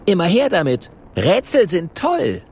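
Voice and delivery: high, bright eager dragon; a touch of eager delight. A narrator's reading. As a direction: relish the challenge. Kokosnuss delighted by riddles.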